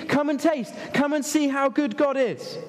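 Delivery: high-pitched, insistent calling; eager invitation. Acted. Preacher calling people to God like a child to Mum, eager. Voices David's call like the child.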